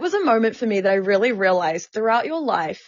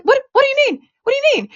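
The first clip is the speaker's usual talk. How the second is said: very high-pitched, baffled reenactment; bewildered protest; 4 dB louder, faster than usual. Retold or imitated. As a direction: act out helpless disbelief, pitch leaping up. Retold bewildered reply to manager.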